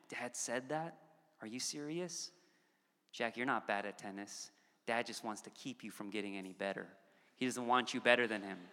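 hushed, insinuating aside; wife confiding. Pretend. Wife to son about dad, hushed mock sympathy. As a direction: drop to a low, soft voice as if sowing doubt. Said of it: "if my wife said to my son".

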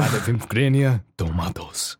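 lightly put-on Eastern European accent; hamming up a foreign accent; mock Eastern European accent. Jokey accented tomato line.

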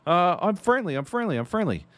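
high-pitched, repeated assurance; urgent retold appeasement. Gamer tells swordsman he is friendly, urgent appeasement. Reassures armed player in retold scene.

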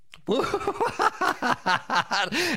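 high, rolling burst of laughter; hearty laugh. Real, not acted. Preacher tickled by his own point, hearty laugh.

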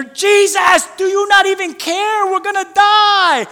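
loud, high, slow panicked cry; panicked shout. Retold or imitated. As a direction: yell it like you're sinking. Panicked disciples shouting in storm.

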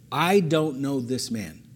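slow, deliberate denial; measured, drawn-out delivery; slow rereading. Shown as a demonstration. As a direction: read it out slowly, weighing each word. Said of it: "let me read it again slowly".

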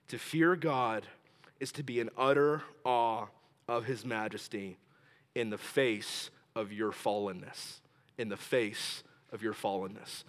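slow, soft, low repeat for note-takers; said slowly. Shown as a demonstration. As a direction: dictate in hushed, measured phrases. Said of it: "Say it slowly so I can write it down".

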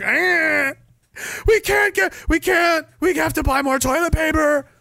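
mock panicky shopper. Mocking lockdown shoppers, high frantic voice. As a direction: play the obedient panicker. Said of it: "walking around looking at arrows on the floor like".

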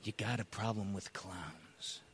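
low, soft, sinister clown; quiet menace. Acted. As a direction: drop low and soft to unsettle him. Clown turns menacing toward interviewer.

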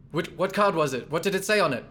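high, urgent questioning; gasp, then press anxiously for an answer; Galahad. Acted. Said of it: "And Galahad says".

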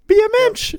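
high falsetto cartoon Yiddish voice; Mickey Mouse voice. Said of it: "That fucking killed me".

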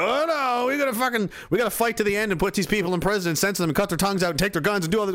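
high, fast frantic rant; government mocked as a panicked ranting official. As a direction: rattle off the list in a frenzy. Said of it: "And they're like".

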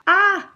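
loud, high-pitched "Ah!"; sudden yelp of inward alarm; short comic scream; alarmed cry. A narrator's reading. What the laugh really meant.

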